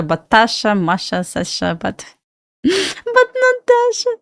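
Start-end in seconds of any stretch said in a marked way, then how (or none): 1.7-4.1 laughing exasperation. Real, not acted. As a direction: voice jumps high, laughing through "not Dasha". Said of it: "many people call me, like, not Dasha".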